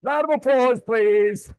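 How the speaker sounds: rally the room to clap; calling for applause. Real, not acted. Trainer calling the audience to applaud the mock wedding, loud.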